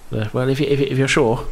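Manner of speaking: put-on high, flustered boy voice; stammer, overwhelmed and awed; timid young Nairn. Young Nairn greeting the miss, flustered.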